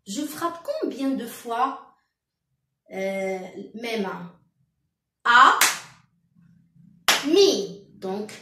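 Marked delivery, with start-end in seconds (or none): 5.2-7.9 loud "A", long gap, then "mi"; "A, mi" broken in two for counting; two beaten syllables. Shown as a demonstration. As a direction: sound out "A... mi" as two separate beats. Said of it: "je frappe deux fois".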